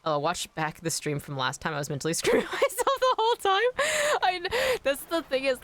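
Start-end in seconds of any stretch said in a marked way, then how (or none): 2.1-5.6 laughing high through the words; giggly, pitched way up. Real, not acted. Laughing at her own stream.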